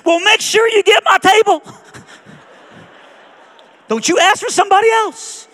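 very high, loud screech, hammed up; retold scream. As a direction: imitate a waitress screaming at full pitch. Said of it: "she just screamed".

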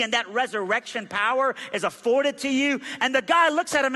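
high, urgent gospel appeal; impassioned preaching. Real, not acted. Man sharing the gospel on the bus, impassioned preaching. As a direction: press each point home with conviction.

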